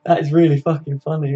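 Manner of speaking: ordinary talk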